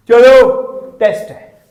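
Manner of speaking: sudden loud call to the class; teacher's announcement. Acted. Lazy teacher springing a test on the class, abrupt announcement. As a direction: call out the first word, then drop back.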